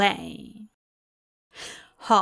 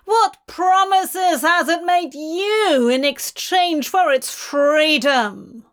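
trembling with fury; 5 semitones higher, 3 dB louder than usual. Acted. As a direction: boom it, quivering with anger. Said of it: "his voice trembling with fury".

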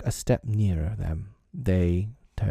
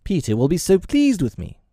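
proud boast; 7 semitones higher than usual. A narrator's reading. Tootles bragging about his shot to the boys, proud. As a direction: savour the boast, pitched high. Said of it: "he cried proudly".

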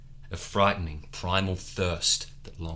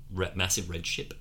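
usual speaking voice

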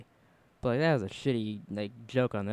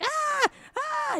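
high mock shrieks of panic; hammed-up squealing; imitated shrieks. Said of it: "when she's like".

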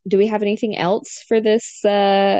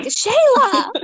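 very high-pitched mock outcry of a name; mock scandalised cry; 3 dB louder than usual. Imitating Tracy seeing the pole dance, mock scandalised cry. Acting out a shocked exclamation.

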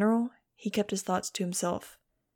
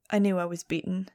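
usual speaking voice

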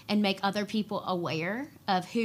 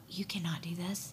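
hushed, unvoiced whisper; soft, secretive whisper of inadequacy; whispered self-doubt; 3 semitones lower, 8 dB quieter than usual. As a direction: whisper it like a discouraging inner voice. Voices inner feeling of inadequacy.

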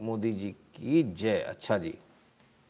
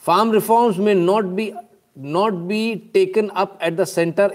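loud, raised-pitch reading of a statement; emphatic read-aloud; 6 semitones higher, 7 dB louder, much slower than usual. Acted. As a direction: read the quote out forcefully, word by word. Reads out a quoted statement. (ज़ोर देकर ऊँची आवाज़ में बयान पढ़ना)

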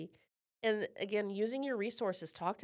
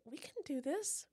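softer, slow, half to herself; quiet resolve; 5 semitones higher, 6 dB quieter, slower than usual. Retold or imitated. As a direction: say it gently, just starting to believe. Retold pep talk, quiet first take.

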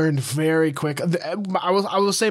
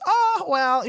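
high, sing-out shrug of indifference; mock dismissive; 13 semitones higher than usual. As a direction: imitate a breezy, high-pitched brush-off. Imitating fiancée who won't stand up.

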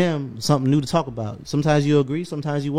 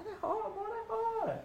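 high, thin excited squeal; mock high-pitched hype; squeaky excited falsetto; 15 dB quieter, slower than usual. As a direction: squeal it like you can't believe it. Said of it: "I'm like".

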